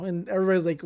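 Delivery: usual speaking voice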